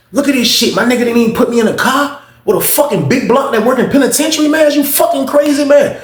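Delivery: very high-pitched, incredulous rant; indignant outburst. Retold or imitated. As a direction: rant in disbelief, pitch climbing to the end. Indignant outburst about being snubbed.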